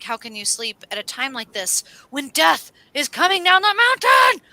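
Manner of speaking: frightened man's pleading whine; panicked voice. Retold or imitated. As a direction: cry out in terror at the end. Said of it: "And he's like".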